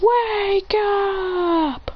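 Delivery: high, drawn-out holler without full volume; mock yell. A narrator's reading. Teacher rousing a daydreaming student, mock yell. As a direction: call it out high and long. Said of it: "he yells".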